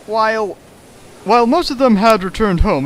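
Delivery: ordinary delivery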